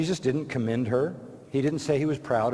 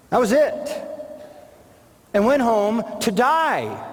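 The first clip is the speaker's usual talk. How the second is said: rising preaching shout; 8 semitones higher than usual. Real, not acted. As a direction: drive the point home at full force. Preacher building to a peak.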